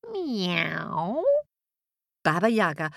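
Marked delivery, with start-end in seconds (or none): 0.1-1.2 long meow sliding upward; cat's meow. Acted. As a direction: meow slowly like a little cat. Cat at the loom.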